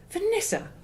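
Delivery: very high, loud scandalised cry; shocked rebuke. A narrator's reading. Mum is appalled at Vanessa's cruel jab, crying out. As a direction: shriek her name in shock.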